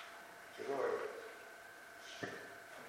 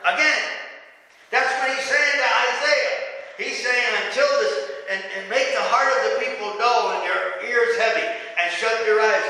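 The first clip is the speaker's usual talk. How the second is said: raised, high-pitched sermon delivery; forceful preaching; 6 semitones higher, 6 dB louder than usual. Real, not acted. Preacher urges congregation to keep preaching forgiveness, at full force. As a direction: preach it hard, driving each phrase home.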